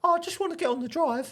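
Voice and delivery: high, put-on neighbour voice; squeaky imitation of a timid neighbour; mock neighbour voice. Neighbour mimicked, high and meek.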